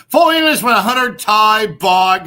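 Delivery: loud shouted read. Real, not acted. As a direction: belt the line out. Shouted reading of donation.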